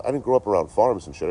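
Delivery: ordinary talk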